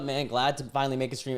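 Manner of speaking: ordinary talk, nothing marked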